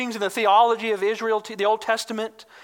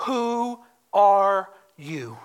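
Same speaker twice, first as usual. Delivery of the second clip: very slow, each word set apart; solemn questioning; 2 semitones lower, much slower than usual. Retold or imitated. Delegation asks John who he is, slow and deliberate. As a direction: ask it word by word, weighty and measured. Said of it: "they ask a simple question".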